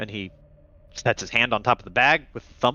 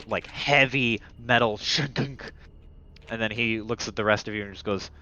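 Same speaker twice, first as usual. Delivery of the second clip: usual speaking voice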